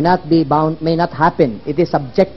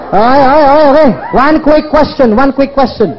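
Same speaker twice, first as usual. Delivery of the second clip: raised, high-pitched call over a crowd; calling for attention; 6 semitones higher than usual. Real, not acted. Speaker settling cheering audience for a question, calling out. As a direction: cut through the noise to regain the room.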